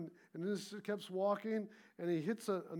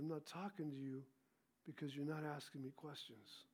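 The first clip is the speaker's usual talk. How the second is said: soft reply; 4 semitones lower, 11 dB quieter than usual. Retold or imitated. Father answers son gently.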